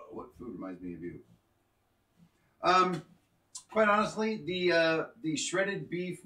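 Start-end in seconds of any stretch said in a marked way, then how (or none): 0.1-1.2 low, soft radio DJ voice; smooth late-night radio host. Pretend. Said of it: "That's a great D J voice".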